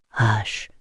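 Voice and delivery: gently quiet her down; soothing hush. A narrator's reading. Vampire hushes the weeping woman, soothing.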